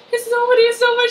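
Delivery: high, loud, frightened brushing-off; mock scared denial. Claudia, caught by the doctor, imitated with high, scared denial. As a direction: insist it's nobody like a scared patient. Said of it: "She looks all scared".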